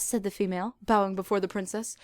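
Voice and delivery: normal talking voice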